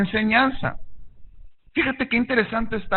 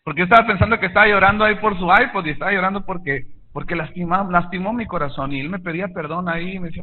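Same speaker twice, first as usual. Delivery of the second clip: normal talking voice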